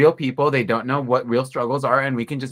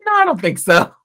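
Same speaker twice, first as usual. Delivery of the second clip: very high, loud diva squeal easing down; Miss Piggy voice; 4 dB louder than usual. Retold or imitated. Miss Piggy turning down Kermit, in a high squealing diva voice. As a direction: hit a shrill-high pig diva note then settle. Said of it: "Miss Piggy goes".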